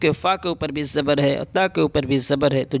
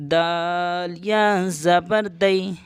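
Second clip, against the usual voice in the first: slow letter-by-letter spelling; teacher spells out نا slowly; 2 semitones higher, much slower than usual. Shown as a demonstration. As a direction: spell نون زبر نا slowly. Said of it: "جلدی پڑھتے ہیں اس طرح سے".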